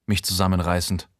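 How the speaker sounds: ordinary delivery, nothing marked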